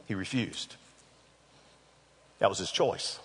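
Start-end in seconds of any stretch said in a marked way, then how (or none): 0.1-0.8 a soft, dropped repeat of "He refused."; quiet. Shown as a demonstration. Preacher lets the refusal sink in, quiet. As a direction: let "He refused." sink to a hushed, heavy close.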